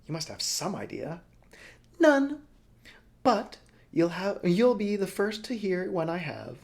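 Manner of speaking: high, sneering sign-off; snide. Acted. Broly signing off to Helva with snide advice. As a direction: needle her with snide mock concern. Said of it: "in that snide advice".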